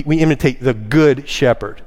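"good" lengthened and punched; stress on GOOD. Shown as a demonstration. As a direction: lean firmly on "good". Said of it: "That word good actually means".

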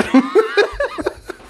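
real high-pitched laugh bursting out; high burst of laughter. Real, not acted. Telling the co-host a dog joke, high real laughter. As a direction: break up laughing at the punchline.

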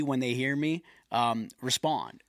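ordinary delivery

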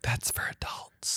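put-on whispered kid's awe. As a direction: hush it like a secret. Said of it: "I was like".

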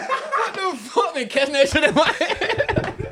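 high, slow words through laughter; laughing. Real, not acted. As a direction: talk while cracking up. Cracking up after the bit.

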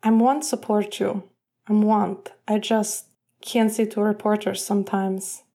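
put-on halting Russian accent. Evgeny confessing to his captain, halting Russian accent. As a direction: struggle to get the words out in a Russian accent.

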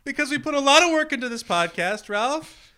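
high, loud mock sobbing rant; hysterical. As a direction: wail it like near tears. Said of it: "[name] is hysterical".

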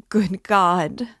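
sudden horrified outcry; anguished cry. A narrator's reading. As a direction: let it burst out of her. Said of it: "broke from Sonia".

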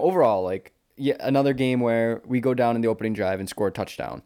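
ordinary delivery

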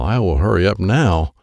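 ordinary delivery

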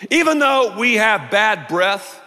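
ordinary delivery

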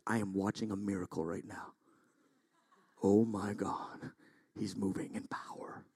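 low, hushed wonder fading to a whisper; hushed mock awe. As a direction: whisper as if witnessing a miracle. Mock awe at his own gentleness.

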